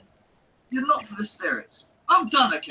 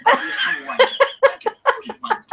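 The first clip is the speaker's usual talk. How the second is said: mock confession; 12 semitones higher, 7 dB louder than usual. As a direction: drag out "I'm a wanker" in high, loud, laughing scorn. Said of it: "Just come and say".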